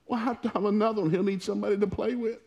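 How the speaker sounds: very high mock-weepy voice; tearful wife, imitated. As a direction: imitate a wife whimpering through tears. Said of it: "she's starting to cry".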